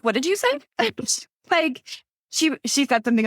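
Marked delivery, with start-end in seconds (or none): 0.0-0.6 shocked question. Retold or imitated. Shocked reaction to mom's comment. 0.6-1.2 incredulous laugh. Real, not acted. Laughing in disbelief.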